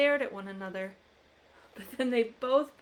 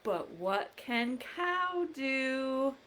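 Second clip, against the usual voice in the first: slow teasing question; 2 semitones higher, much slower than usual. Acted. Refrain asked to children.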